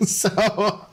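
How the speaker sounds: light laugh into the next word; amused laugh. Real, not acted. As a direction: chuckle at himself. Laughing at own joke.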